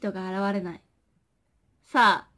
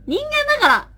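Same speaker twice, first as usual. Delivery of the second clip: squeaky insistence; 6 dB louder than usual. Pretend. Insisting she's human, playful.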